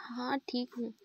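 whispered, voice barely there; nervous whisper. Acted. The sweating Major whispers to Jess that she's fine. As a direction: breathe it out unvoiced, hiding the nerves.

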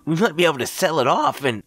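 high, fast, eager friend; excited young man voice. Acted. Greg voiced in story.

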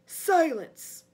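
commanding villain's outburst; sharp command. Acted. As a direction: silence the servant at once. Master cuts off sputtering servant.

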